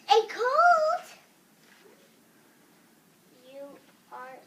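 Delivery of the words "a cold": loud, shooting high in disbelief; astonished. Acted. Gerald hears Doctor Cat's diagnosis, astonished. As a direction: shriek the question back, pitch soaring.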